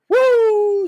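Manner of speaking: high, loud celebratory whoop; loud woo. Real, not acted. Fan cheering the return, a loud whoop. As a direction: let out a joyful whoop.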